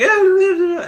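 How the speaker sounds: high, loud mock sobbing wails; imitated crying. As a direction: ham up the tearful wail. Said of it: "I start, like, crying".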